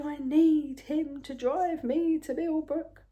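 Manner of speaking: panicky. Retold or imitated. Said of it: "in a very panicky voice".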